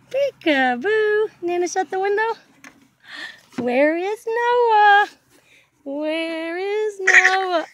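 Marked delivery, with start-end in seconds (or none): 3.6-7.6 slow teasing call. Real, not acted. Peekaboo game with baby.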